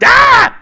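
short, piercing high yell; loud imitation scream; imitated comic's scream. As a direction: blast out a manic comedian's shriek. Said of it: "like when he would do that, he was like".

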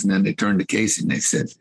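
normal talking voice

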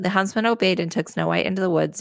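ordinary delivery

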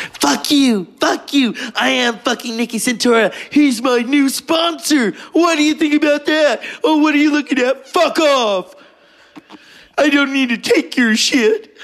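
high-pitched, full-tilt movie tirade; cussing someone out. Retold or imitated. Casino character cussing out Robert De Niro on the lawn, furious. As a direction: let rip with mock fury, voice way up. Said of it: "she's cussing out Robert De Niro".

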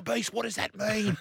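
high, urgent anxious question; a touch of in-flight panic; panicked question. Retold or imitated. Asking his mate mid-scare, high and anxious.

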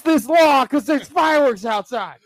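mocking dog owner. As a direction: shout it like a hysterical dog owner. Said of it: "They're like".

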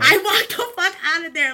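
emphatic declaration. Real, not acted. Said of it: "emphatic echo".

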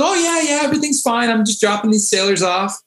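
high, casual sheriff reply; breezy sheriff. Retold or imitated. Sheriff tells guard he's dropping sailors off, breezy and high. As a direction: brush it off lightly.

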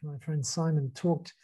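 ordinary delivery

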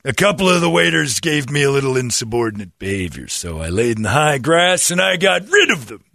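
high, loud quirky manager voice; lightly voiced eccentric manager; Gene Wilder. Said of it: "thanks, Gene Wilder".

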